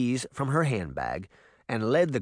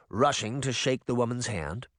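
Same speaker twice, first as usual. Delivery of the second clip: usual speaking voice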